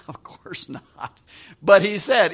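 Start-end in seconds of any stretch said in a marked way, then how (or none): 0.1-1.2 breathy whisper, slow; whispered aside. Real, not acted. Preacher answers his own question in a whisper. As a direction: drop to a hushed, slow aside.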